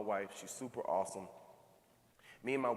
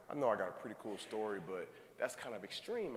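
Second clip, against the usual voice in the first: usual speaking voice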